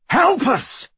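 high, frantic yell for help; desperate yell. A narrator's reading. Trapped boy pleading into a mirror, desperate high yell. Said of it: "he yelled at it in mad desperation".

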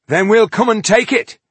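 loud, high battle threat; fierce threat. Acted. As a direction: throw it like a challenge. Threat to the strangers.

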